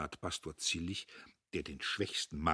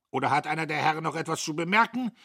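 brusque challenge; 8 semitones higher, 9 dB louder, much faster than usual. Acted. Major demanding objections.

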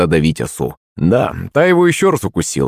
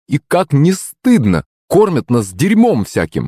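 high, drawn-out female indignation; indignant cry; 6 semitones higher, slower than usual. A narrator's reading. Woman in the crowd scolding the food sellers, indignant. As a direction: protest slowly with pitched-up outrage. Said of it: "возмущённо вскрикнул чей-то женский голос".

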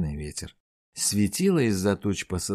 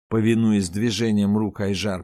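plain reading voice

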